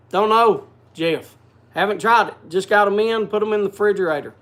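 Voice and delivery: normal talking voice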